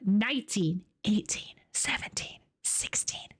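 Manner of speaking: unvoiced whispered numbers; whispered countdown. Real, not acted.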